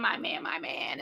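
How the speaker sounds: breathy whisper, lovesick; whispered swoon. Retold or imitated. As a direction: sigh the words out in a whisper. Mimicking a lovesick woman.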